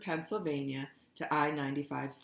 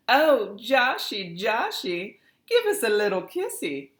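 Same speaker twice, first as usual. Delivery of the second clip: fake Southern accent; 8 semitones higher, 10 dB louder than usual. A narrator's reading. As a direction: coo it in a mock Southern belle accent. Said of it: "croon in a fake Southern accent".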